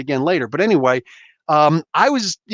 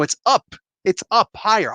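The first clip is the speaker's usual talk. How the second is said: ordinary delivery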